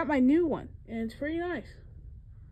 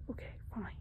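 whispering; 15 dB quieter, faster than usual. Acted. Giving in.

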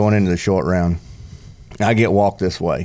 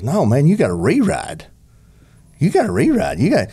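higher, eager insisting; insistent; 6 semitones higher than usual. Retold or imitated. A buddy urging the rider, high and insistent. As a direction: mimic a buddy pushing him to ride. Friend insists in retold story.

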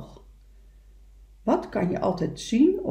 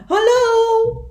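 high, loud, sustained hello; echoed call; 13 semitones higher, 9 dB louder than usual. Acted. Echo answering the beaver across the lake, loud high call. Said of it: "riep de stem aan de overkant".

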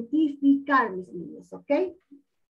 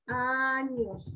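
"Años" softly stretched into two syllables; 2 semitones lower, 6 dB quieter than usual. Shown as a demonstration. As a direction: draw out "Años" quietly, syllable by syllable. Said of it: "Así vamos a hacer".

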